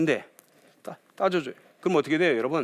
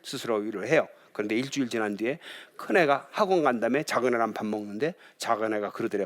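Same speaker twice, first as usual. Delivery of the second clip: usual speaking voice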